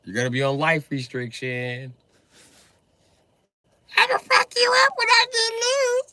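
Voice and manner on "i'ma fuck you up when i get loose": screaming threat, much higher and louder; furious shout. Real, not acted. Partner pinned down, screaming furious threats. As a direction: yell it while pinned down. Restrained partner yelling back.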